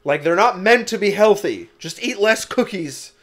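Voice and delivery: exasperated. Retold or imitated. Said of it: "I get infuriated".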